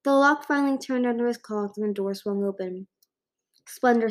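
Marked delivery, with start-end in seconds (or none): none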